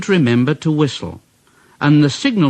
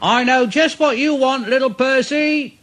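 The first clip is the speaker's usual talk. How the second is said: higher friendly call; higher kindly signalman voice; 9 semitones higher than usual. A narrator's reading. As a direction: call out knowingly to the engine. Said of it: "the signal man called out".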